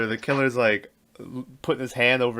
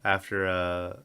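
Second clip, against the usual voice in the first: ordinary talk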